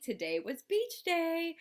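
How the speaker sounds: high, slow and bright-eyed announcing; cheery high opening call; excited. Real, not acted. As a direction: sing it out like big news.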